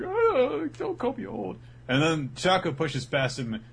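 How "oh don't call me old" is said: high, hurt mock whine; hammed-up high protest; offended whine. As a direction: whine it, stung by the insult. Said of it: "He's like".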